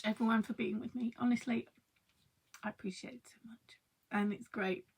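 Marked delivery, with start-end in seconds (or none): 2.6-4.9 soft, unvoiced grateful whisper; whispering thanks. Real, not acted. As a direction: whisper it sincerely. Tender thanks to viewers.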